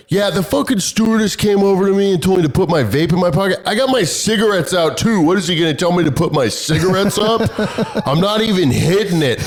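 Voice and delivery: mock outraged complaining to a relative; retold indignant griping. Indignant man complains to sister.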